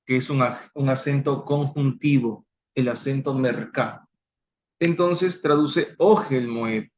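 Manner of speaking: ordinary delivery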